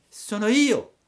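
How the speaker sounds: acuto e forte; burattino. A narrator's reading. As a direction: grida su alla finestra. Pinocchio risponde. (Pinocchio risponde alla lumaca, acuto e forte)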